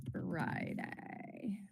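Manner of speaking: breathy whispered aside, playful. Pretend. Host listing poll days, whispering the last option. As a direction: drop to a whisper on the second choice.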